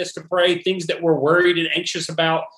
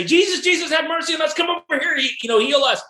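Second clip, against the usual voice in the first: pleading calls pitched far higher, stretching each plea; crying out; 11 semitones higher, faster than usual. Retold or imitated. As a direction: call out to Jesus across a crowd, begging. Crowds crying out to Jesus.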